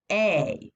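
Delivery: "A" said as the letter's name, "ay"; long A sound. Shown as a demonstration. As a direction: isolate "A" as a clear "ay". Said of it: "It says its name".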